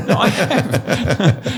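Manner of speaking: slow, laughing insistence. Real, not acted. Assuring the host it really happened, laughing. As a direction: laugh through the words, drawn out.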